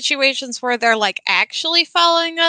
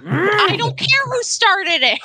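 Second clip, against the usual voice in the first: put-on refereeing kids in car; 8 semitones higher than usual. Parent scolding kids.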